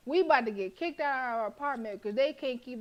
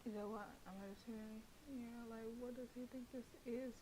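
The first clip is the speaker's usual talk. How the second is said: much softer, half under the breath; quiet aside; 17 dB quieter than usual. Real, not acted. Player muttering to viewers, softer aside. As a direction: confide it to the chat.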